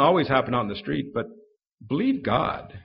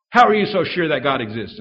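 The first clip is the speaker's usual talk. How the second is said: heckler's challenge; 3 semitones higher, 8 dB louder than usual. Retold or imitated. Woman in crowd challenging.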